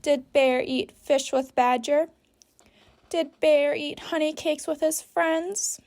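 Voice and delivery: normal talking voice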